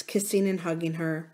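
ordinary delivery

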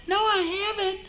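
very high woman's voice. Retold or imitated. Waitress answers the robber in a very high woman's voice. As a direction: answer in a high ladylike pitch.

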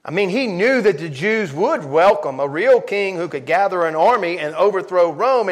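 normal talking voice

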